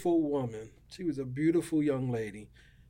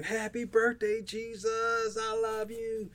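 retold yell; 8 semitones higher, slower than usual. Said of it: "scream as loud as I can".

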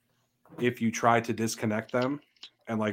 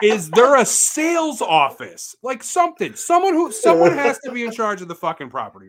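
exasperated; 11 semitones higher, 10 dB louder than usual. Retold or imitated. Asking the offended resident for the office, exasperated. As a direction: stretch it out in high exasperation. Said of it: "retells her own exasperated question".

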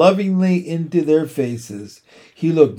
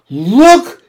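high, loud, rising call; excited cry; 9 semitones higher, 10 dB louder than usual. A narrator's reading. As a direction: call everyone over with a sudden cry. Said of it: "cried Fern".

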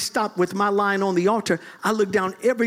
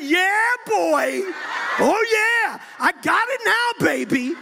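shouting for joy; 9 semitones higher, slower than usual. Retold or imitated. Preacher reenacts his breakthrough to the congregation, shouting in triumph. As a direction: yell it out like you just got the power. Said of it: "I turned around here and I went".

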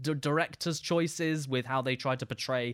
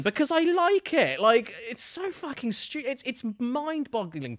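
real exasperation, pitched way up; exasperated outburst; 9 semitones higher than usual. Real, not acted. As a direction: blurt it out in disbelief.

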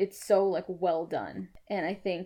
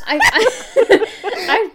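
laughing; 13 semitones higher, 12 dB louder, much slower than usual. Real, not acted. Laughing at own idea.

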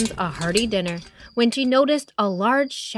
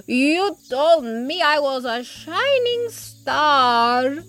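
porcupine voice; 3 semitones higher, slower than usual. Acted. Porcupine recalls kindness.

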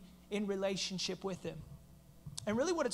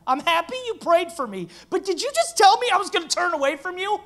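much louder and higher, Peter shouting in disbelief; alarmed Peter; 8 semitones higher, 10 dB louder than usual. Pretend. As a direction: cry out as Peter, shocked at the news. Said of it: "If I'm Peter, I'm like".

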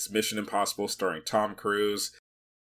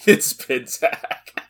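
high, loud, drawn-out mimicry; mocking echo; 5 semitones higher, 6 dB louder, much slower than usual. Co-host parroting a bad joke, exaggerated mocking echo. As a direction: stretch it out in mock imitation.

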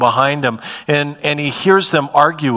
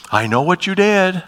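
mock scolding parent; 6 semitones higher than usual. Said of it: "Doesn't scold us like a parent".

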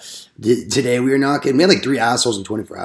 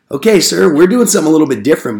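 higher, louder, fast customer-service politeness; overly polite; 4 semitones higher, 6 dB louder than usual. Retold or imitated. Salesman soothing a hostile homeowner, bright overpolite. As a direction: chirp it sweetly to defuse him. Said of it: "And I was like".

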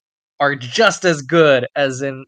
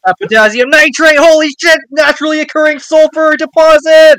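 screamed much higher and louder throughout; manic hype shout; 14 semitones higher, 11 dB louder than usual. Retold or imitated. The show excitedly revealing chemicals, a loud high shout. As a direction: yell every word like the best news ever.

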